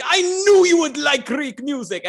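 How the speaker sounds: put-on high, loud excited Greek accent; hammed-up Greek dentist.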